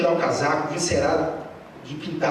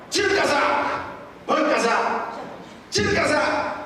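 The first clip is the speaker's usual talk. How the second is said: sharp commands, higher pitch; barked orders; 6 semitones higher than usual. Retold or imitated. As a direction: snap orders like a strict master. Mestre dando ordens ao aluno. (ordens ríspidas de mestre, voz mais aguda)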